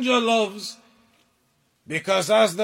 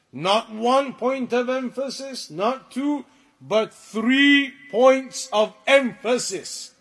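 "three" slowed and pressed at the peak of the build; building emphasis. Shown as a demonstration. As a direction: build from "one" and "two" to a firm "three".

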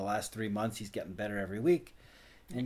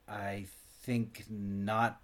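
slow firm verdict; much slower than usual. Real, not acted.

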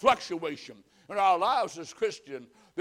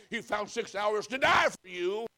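ordinary talk

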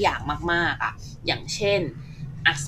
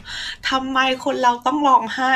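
teary question; 7 semitones higher, slower than usual. Retold or imitated. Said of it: "ปาดน้ำตามองเบลอๆ Google ไปด้วย ว่าแบบ".

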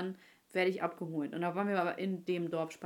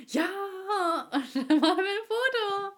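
high voice breaking into laughter; laughing; 12 semitones higher, 7 dB louder than usual. Retold or imitated. Telling her friend about fan photos, laughing high. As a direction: laugh through the retold line.

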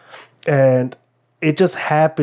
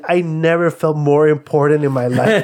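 higher, louder excited retelling; reliving a fan's big thrill; 4 semitones higher, 3 dB louder than usual. Retold or imitated. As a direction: gush about feeling important. Retelling thrill of being singled out.

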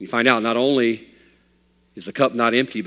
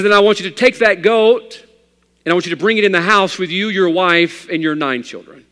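loud and high, firm and fast instructions; insistent preacher; 7 semitones higher, 8 dB louder than usual. Acted. Preacher to his troubled parishioner, loud and insistent. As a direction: lay down the law to the poor man, quickly. Preacher gives absurd orders in story.

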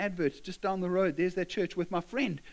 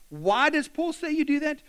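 emphatic question; 8 semitones higher, 6 dB louder than usual. Real, not acted. Preacher's raised rhetorical question.

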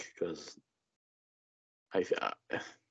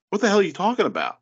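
much higher, fast exasperated disbelief; incredulous; 11 semitones higher, 3 dB louder, much faster than usual. Retold or imitated. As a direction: blurt it in disbelief. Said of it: "you're like".

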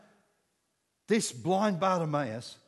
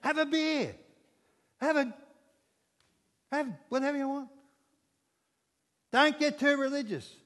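higher, coaxing tempter voice; tempting devil; 8 semitones higher than usual. Acted. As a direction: sweet-talk him into sinning. How the devil offers you stuff.

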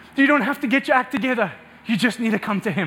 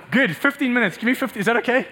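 ordinary talk, nothing marked

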